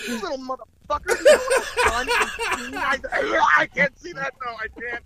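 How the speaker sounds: retching disgust. Retold or imitated. Dad changing a toddler's dirty diaper, mock gagging. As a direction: act out fighting back vomit mid-sentence. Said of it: "trying to hold back the fucking six Budweisers".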